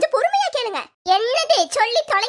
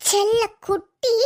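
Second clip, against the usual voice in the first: செல்ல குட்டி stretched slowly and lower; fond coo; 4 semitones lower, slower than usual. Shown as a demonstration. Daughter cooing செல்ல குட்டி to her mother, slow and fond. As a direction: drag out செல்ல குட்டி fondly. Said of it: "repeats the endearment".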